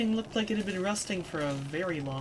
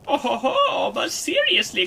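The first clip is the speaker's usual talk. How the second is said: loud, raised crone's chortle; chuckling crone; 6 semitones higher, 8 dB louder than usual. Acted. Crone amused by Kid's act, chuckling. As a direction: chuckle knowingly like an old crone.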